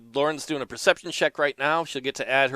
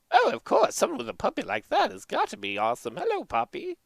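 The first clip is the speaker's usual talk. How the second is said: put-on higher, practical old wife; lightly voiced old wife; 9 semitones higher than usual. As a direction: chime in as a sensible old wife. Gina coos at puppy.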